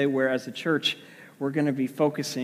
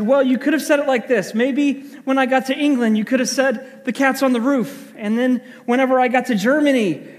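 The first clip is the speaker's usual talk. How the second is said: much higher and louder, explaining step by step; lecturing wife; 9 semitones higher, 6 dB louder than usual. Retold or imitated. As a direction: spell it out to him like a patient scold. Wife lectures husband on gentle news.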